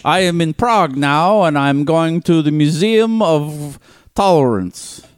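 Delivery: high, slow mock Russian accent. Podcaster as Putin hosting a travel show, Russian accent. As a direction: play a Russian leader as vlogger, heavy accent. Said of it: "Did you watch his traveling shows?".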